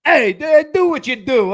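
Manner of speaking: put-on loud, high New York accent call-out; late-eighties New York. Co-host mimics friend's New York accent, loud and brash. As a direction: holler it like an old-school New Yorker. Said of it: "like, late eighties New York".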